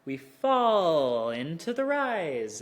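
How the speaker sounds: "fall" stretched and swooping down, "rise" gliding up; slow swooping voice acting out "fall into the rise". Shown as a demonstration. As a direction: draw out "fall" like the tone itself. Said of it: "falling tone into a second tone".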